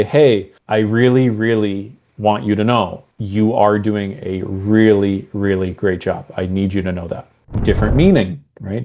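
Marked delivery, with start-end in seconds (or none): 0.0-7.3 slow down and mean every word; slow and sincere. Shown as a demonstration. Boss praises an employee slowly and sincerely. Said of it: "Different speed".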